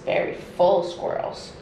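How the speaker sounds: usual speaking voice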